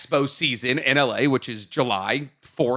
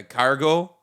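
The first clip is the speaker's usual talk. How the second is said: put-on Minnesota accent; 2 semitones lower than usual. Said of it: "that's like Minnesota".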